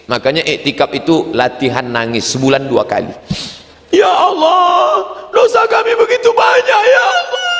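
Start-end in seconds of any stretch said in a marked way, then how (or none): 3.9-7.5 sobbing Ya Allah; crying prayer. Retold or imitated. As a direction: cry out to God in tears.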